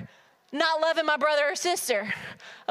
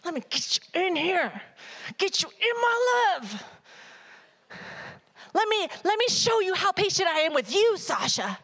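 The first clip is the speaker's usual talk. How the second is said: a tender, higher voice of God coaxing; God gently pleading with a stubborn child; 5 semitones higher than usual. Acted. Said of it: "He always wanted".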